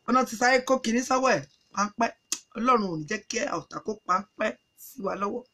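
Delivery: plain reading voice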